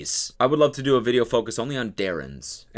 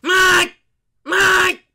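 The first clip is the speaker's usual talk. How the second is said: piercing very high loud Mike; mock alarm yell; 11 dB louder than usual. Commentator mimics the clip, very high loud shriek of Mike. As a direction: shriek it like a siren. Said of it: "it sounds like an alarm".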